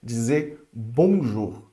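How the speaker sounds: slow, careful "bonjour"; teacher models "bonjour" slowly; French greeting. Shown as a demonstration. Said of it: "isso aqui é vital".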